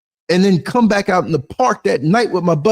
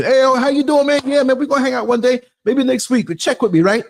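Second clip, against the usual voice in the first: mock friendly brush-off; 8 semitones higher than usual. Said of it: "acting friendly brush-off".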